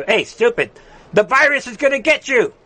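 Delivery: high, sing-song mocking voice; mock taunt. Host taunting a maskless leader, high mocking jeer.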